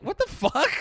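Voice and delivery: high, startled mock outrage; mock shock. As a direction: react in fake disbelief. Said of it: "You're like".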